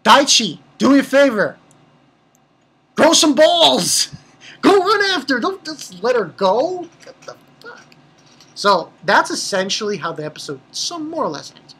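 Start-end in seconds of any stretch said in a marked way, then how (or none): 0.0-6.9 loud, high exasperated rant; yelling at the screen. Real, not acted. Viewer yelling at Daichi to chase Hana, loud exasperation. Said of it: "shouting advice at character". 7.0-7.8 whispered exasperated curse. Real, not acted. Viewer muttering at Daichi's failure, whispered disbelief. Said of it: "exasperated aside".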